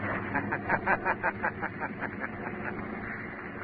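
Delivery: sinister whispery laugh from nowhere; breathy mocking cackle, soft and distant; eerie laugh. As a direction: laugh like an unseen phantom. Shadow's unseen mocking laugh.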